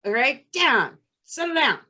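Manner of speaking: a mom yelling down from the basement; retold yelling mom. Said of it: "Louise starts screaming at the kids".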